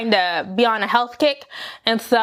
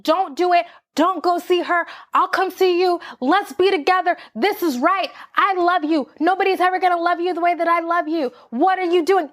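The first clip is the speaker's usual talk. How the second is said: higher, retold pleading without real tears; mock begging; 6 semitones higher than usual. Retelling how she begged her ex on the phone, higher and pleading. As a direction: replay the desperate plea lightly. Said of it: "begging this man".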